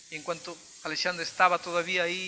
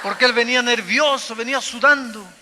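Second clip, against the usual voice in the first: rising to a full-force, much louder preaching cry; fervent preaching; 6 semitones higher, 15 dB louder than usual. Acted. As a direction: preach it out with mounting fire. Preaching burst. (predicación ferviente, cada vez más fuerte)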